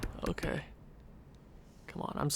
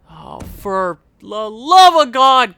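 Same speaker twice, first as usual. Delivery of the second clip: slow, louder groan of despair; exasperated; 7 dB louder, slower than usual. Real, not acted. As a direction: drag it out in frustration. Exasperated at goalie.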